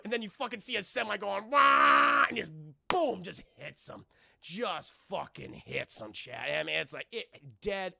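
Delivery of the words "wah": loud imitated horn blare; mock horn noise; truck horn.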